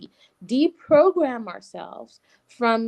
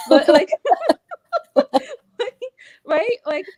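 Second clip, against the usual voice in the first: laughing; 9 dB louder than usual. Real, not acted. Laughs at her own example.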